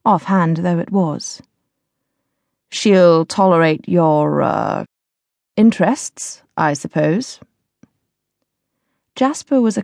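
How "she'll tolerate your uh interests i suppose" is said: put-on slow, dry, slightly disdainful older lord; lightly voiced older lord. A narrator's reading. Walsingham voiced in narration.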